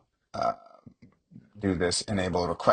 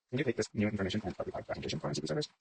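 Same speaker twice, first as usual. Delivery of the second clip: quick, quiet read-out of a typed prompt; rushed mumble; 4 semitones higher, 9 dB quieter, much faster than usual. Real, not acted. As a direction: rattle it off under your breath. Reading out typed prompt to agent.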